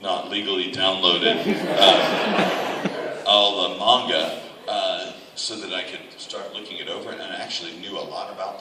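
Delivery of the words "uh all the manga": laughing. Real, not acted. Laughing about illegal downloads.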